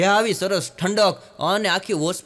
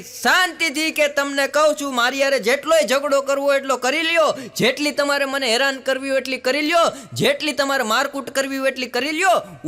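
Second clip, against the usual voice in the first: wife in a quarrel; 6 semitones higher than usual. Acted.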